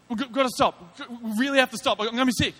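hammed-up alarm, someone about to be sick; urgent plea. Hitchhiker urging the driver to stop the car, mock panic.

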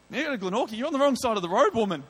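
a tetchy rant said in his head; exasperated inner thought. Retold or imitated. Preacher voicing his annoyed thought at the hitchhiker, mock indignant. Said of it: "I didn't say woman, that's what I was thinking".

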